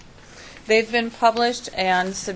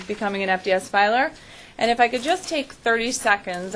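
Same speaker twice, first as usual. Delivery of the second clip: ordinary talk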